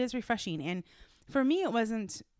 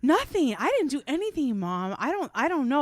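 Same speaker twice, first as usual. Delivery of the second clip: mock kid denying; 6 semitones higher, 6 dB louder than usual. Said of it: "I'm like".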